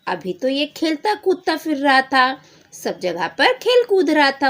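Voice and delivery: loud, high astonished dad; surprised father. Acted. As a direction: exclaim as a baffled father. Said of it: "उसके पापा बोलते हैं". (हैरान पिता, ज़ोर से)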